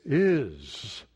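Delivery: "Is" said alone, low and weighty; answering his own question with one word; stress on IS. Shown as a demonstration. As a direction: land hard on the single word "Is". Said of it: "what's the next word?".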